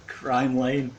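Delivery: trying to talk through laughter; real laughing. Real, not acted. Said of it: "I'm sorry, I'm laughing".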